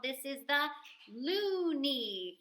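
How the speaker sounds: ordinary talk, nothing marked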